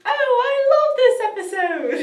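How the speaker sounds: high, loud mock-kid squeal of delight; excited kid imitation. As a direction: gush high and loud with laughter.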